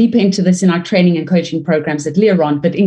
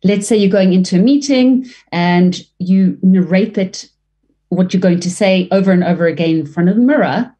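ordinary talk, nothing marked

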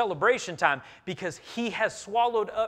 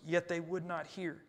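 usual speaking voice

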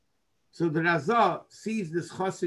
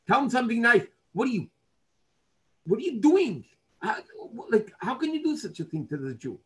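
put-on higher-pitched, exasperated older-brother rebuke; indignant scolding; 6 semitones higher than usual. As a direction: act out an incredulous telling-off of a younger brother.

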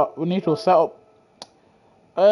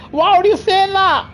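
mock outrage; 8 semitones higher than usual. Mock outrage while laughing.

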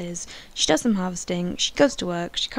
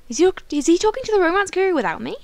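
indignant rush; 9 semitones higher, 8 dB louder, much faster than usual. Real, not acted. Boyfriend ignoring her in game.

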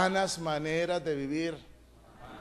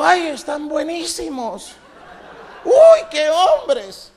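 much higher and louder, slow, squealing with a laugh; mock swooning men; 7 semitones higher, 13 dB louder, slower than usual. Sodom's men ogling the angels, loud high mock swoon. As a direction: gush over the handsome angels in a camp falsetto.

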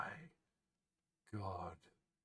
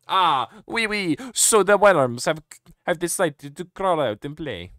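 put-on French accent; 2 semitones higher, 11 dB louder than usual. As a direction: ham up a booming French villain. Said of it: "Is he actually just French?".

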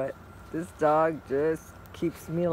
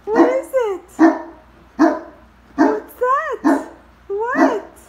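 squeaky high voice for the puppy; 14 semitones higher, 9 dB louder, slower than usual. Real, not acted. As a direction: coo at the dog in a high sing-song. Talking baby talk to her dog.

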